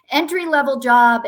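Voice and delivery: mock-indignant emphasis; exasperated emphasis. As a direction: stress the absurdity of entry-level. Mocking an unrealistic job ad.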